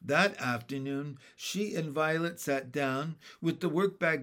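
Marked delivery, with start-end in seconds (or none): none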